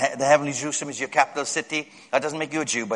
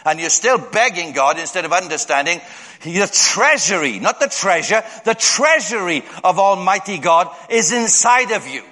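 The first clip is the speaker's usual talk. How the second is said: usual speaking voice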